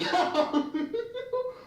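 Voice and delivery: high-pitched mimicked giggle; imitated giggling. Friend giggling with his homegirl, imitated high giggle. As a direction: giggle high, copying someone behind your back. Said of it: "giggling, laughing, like".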